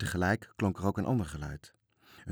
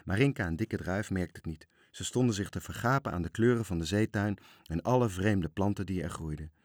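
usual speaking voice

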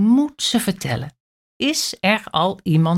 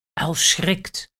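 ordinary delivery

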